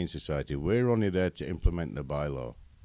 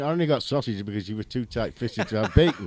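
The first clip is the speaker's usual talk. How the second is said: laughing while teasing; 5 semitones higher, 8 dB louder than usual. Real, not acted. Teasing host about breakfast order.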